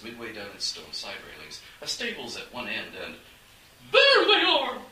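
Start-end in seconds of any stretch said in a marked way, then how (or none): 3.9-4.8 very high, loud, shrill shriek; cold lady host shrieking at the fleeing thieves. A narrator's reading. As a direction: screech it down from a window above. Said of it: "The shriek came from right overhead".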